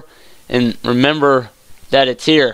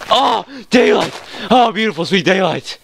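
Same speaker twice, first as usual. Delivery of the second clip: overjoyed relief; 8 semitones higher than usual. Real, not acted. Player finally reaching the surface, overjoyed relief. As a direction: greet it like a long-lost love.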